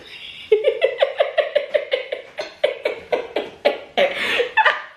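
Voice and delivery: high, unvoiced giggling laugh; real giggle fit; breathless high laugh. Real, not acted. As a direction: laugh helplessly, airy and high. Laughs after husband's reaction.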